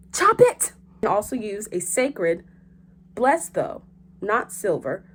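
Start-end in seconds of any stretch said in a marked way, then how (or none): 0.1-0.7 shouted high and sharp; loud command. Acted. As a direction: yell it like swinging an axe. Urging to chop off vampire head.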